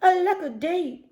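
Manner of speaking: theatrical sigh of woe; mock lament. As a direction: wail it like a tragic hero. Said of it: "he cried".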